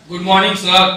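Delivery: loud, mock-angry greeting thrown at someone. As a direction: fling the greeting out loudly, as if angry. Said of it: "कितना गुस्सा उसके अंदर है". (गुस्से में फेंका हुआ अभिवादन)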